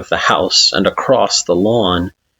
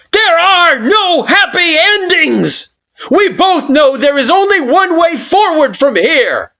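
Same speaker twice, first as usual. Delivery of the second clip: shrieking with rage; 4 dB louder than usual. Acted. Kara raging at you in the storm, furious shriek. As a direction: scream it in fury. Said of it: "They shriek with rage".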